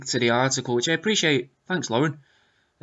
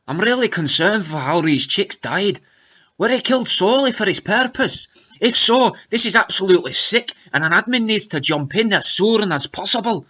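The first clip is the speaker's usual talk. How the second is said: lilting put-on Scottish, pitched up; hammy Scottish accent, higher and louder; fake Scottish accent; 5 semitones higher, 5 dB louder than usual. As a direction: do an indignant comment in fake Scots. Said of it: "in a fake Scottish accent".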